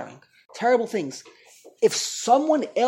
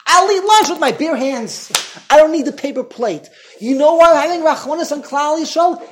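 furious outburst, much louder and higher; explodes; 6 semitones higher, 7 dB louder than usual. Acted. God rebuking the Kohanim over the paper plates, enraged shout. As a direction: thunder with outrage at the insult. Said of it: "So Hashem explodes".

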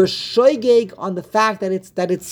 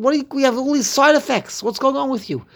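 higher-pitched playful ribbing; mock teasing; 7 semitones higher than usual. Teacher ribbing his study partner for being worked up, higher mock teasing. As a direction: tease him about being all worked up.

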